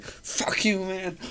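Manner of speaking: high, strained mock outburst; upset girl. Imitating a girl upset by his questions, high mock-upset. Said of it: "she was getting so upset".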